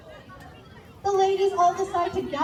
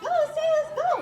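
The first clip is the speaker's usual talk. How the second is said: cheering; 9 semitones higher, faster than usual. A narrator's reading. Ladies cheering on the sailors in battle. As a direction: cheer them on. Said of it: "cheering, saying".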